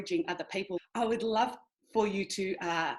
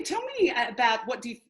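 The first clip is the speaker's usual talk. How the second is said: ordinary talk, nothing marked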